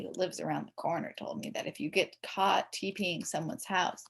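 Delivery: usual speaking voice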